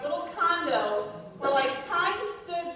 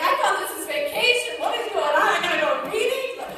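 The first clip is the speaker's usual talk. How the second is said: higher, louder incredulous complaint; mock indignant surprise; 5 semitones higher, 6 dB louder than usual. Wife on vacation discovering an agenda of meetings, incredulous. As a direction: act out the outrage of being tricked.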